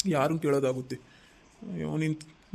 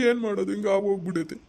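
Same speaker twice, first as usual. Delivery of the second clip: ಏನು ಮಾಡೋದು said as he starts to cry; husband bursts into tears over lost job; 5 semitones higher than usual. Acted. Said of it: "ಏ, ಅಳ್ಬೇಡಪ್ಪ".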